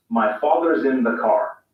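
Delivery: slow American accent with sounded R; American rhotic delivery. Shown as a demonstration. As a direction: pronounce the R in father's and car, American style. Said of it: "Listen to the difference".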